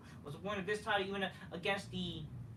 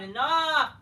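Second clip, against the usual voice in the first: loud, higher cry of exasperation; fed-up shout; 8 semitones higher, 12 dB louder than usual. Real, not acted. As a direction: yell it like you've had it. Exasperated rant at wrestling promo.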